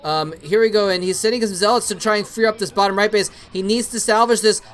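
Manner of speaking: ordinary talk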